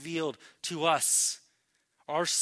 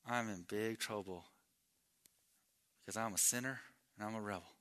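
sobered confession; 7 semitones lower, 11 dB quieter than usual. Acted. Preacher as Isaiah admitting he's a sinner, sobered confession. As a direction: quietly own up to guilt. Restates Isaiah's woe in plain words.

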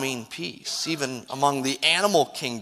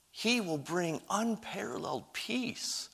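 breathy hushed whisper of awe; 2 semitones higher, 14 dB quieter than usual. Real, not acted. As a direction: drop to a whisper to pull them in. Preacher drops to hushed climax.

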